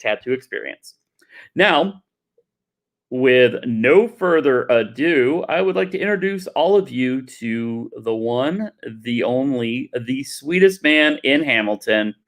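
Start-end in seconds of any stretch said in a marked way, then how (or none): 1.5-12.1 slow, building hype for the next guest; announcer intro. Acted. Host introduces Max to the crowd, announcer style. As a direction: bring him on like an emcee, drawn out.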